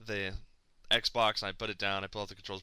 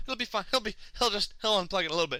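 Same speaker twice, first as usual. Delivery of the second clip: high, strained reassurance; forced calm; 8 semitones higher than usual. Retold or imitated. Telling himself the Xbox will survive, high strained self-reassurance. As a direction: squeak out that it's all fine.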